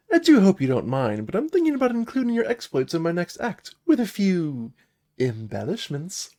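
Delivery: high, lilting theatrical bard voice; high-pitched, sly performer; playful bard. Acted. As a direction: pitch the act to a hero with showy flair.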